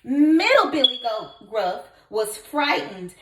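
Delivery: usual speaking voice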